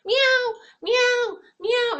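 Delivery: high, loud cat meowing; imitating cat meows. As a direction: mimic a cat calling for attention. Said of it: "she's like".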